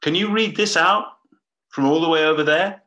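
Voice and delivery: high, loud mock call from the back; heckler calling out. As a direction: shout it up from the back of the room. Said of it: "type something on a pad and ask".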